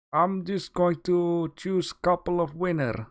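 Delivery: hammed-up Russian English that drops the articles; a half-hearted try at a Russian accent; bad Russian accent. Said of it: "That's probably very bad Russian English".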